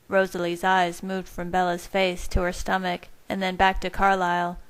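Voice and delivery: ordinary delivery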